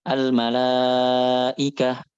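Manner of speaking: AL-MALAIKAH very slow, long held "laa", clean "ikah"; measured hamzah. Shown as a demonstration. Teacher models reading in due portion, measured hamzah in AL-MALAIKAH. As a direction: stretch "laa" in "Al-malaikah", then a light catch into "ikah". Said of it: "kita baca sesuai porsinya".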